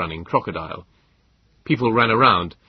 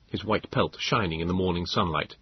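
usual speaking voice

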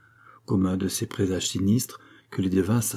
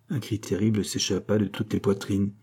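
usual speaking voice

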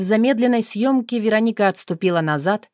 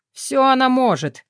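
higher, strained huff; panting strain; 7 semitones higher than usual. A narrator's reading. Artyom pinning Roma down, straining and huffing. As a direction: puff it out while wrestling. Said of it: "пыхтел Артём".

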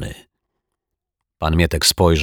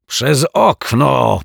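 high, louder, slow fuming; 7 semitones higher, 5 dB louder, much slower than usual. Acted. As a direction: seethe the words out. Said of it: "pieklił się pan Mietek". (wściekle, z furią)

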